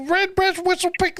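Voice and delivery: high-pitched mocking repeat of drink names; mock foreign-sounding names. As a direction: parrot the names way up high, teasing. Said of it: "you're talking foreign language".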